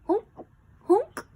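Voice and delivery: loud high honking; horn honk. A narrator's reading. Imitated horn.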